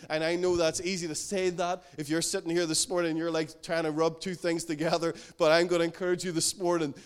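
ordinary talk

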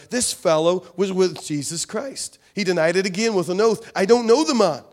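dramatic reading of Peter's denial; emphatic scripture reading. Acted. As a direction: read the passage with rising force. Reads the scripture passage aloud.

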